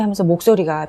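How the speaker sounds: ordinary delivery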